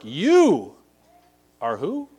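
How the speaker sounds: "You" punched high and loud, pause, then "are who?"; stress on YOU. Shown as a demonstration. Preacher shows the Greek emphasis, YOU thrown out first and loud. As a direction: hit "You" hard and hold off the rest. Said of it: "That's the emphasis".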